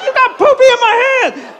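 put-on high-pitched little-girl wail; mock toddler tantrum, very high; upset granddaughter. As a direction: imitate a child accusing Grandpa in distress. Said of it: "And she goes".

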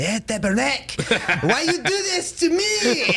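hammed-up French-Canadian accent, slow then squeaky high. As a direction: imitate a French buddy, cursing and laughing. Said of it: "So he's got the thick Frank-, French accent".

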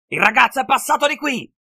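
pursuer's yell. A narrator's reading. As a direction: yell it out to alert the others. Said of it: "urlò il suo inseguitore".

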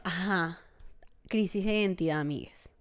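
ordinary talk, nothing marked

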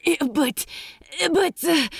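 high, stammering panic; panicked stammer. Acted. Crowley stammering at his horrible orders, panicked. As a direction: trip over your words in horror. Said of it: "stumbled for coherency in his panic and horror".

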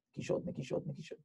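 whispered, fading repetition; hushed echo. Retold or imitated. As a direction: let the word die away in a whisper. Retelling knocks heard at the door. (לחישה דועכת)

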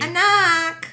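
high, loud mock Filipino-mom call; exaggerated Filipino mother's accent. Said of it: "Do your, do your mom's accent".

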